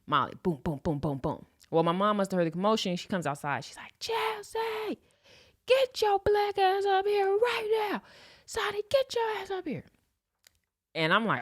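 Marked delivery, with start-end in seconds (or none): 4.0-9.8 high, slow mock yell of a furious mother; angry mom yelling. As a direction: mom calling the kids in, dragged out and high. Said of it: "gets to yelling and stuff".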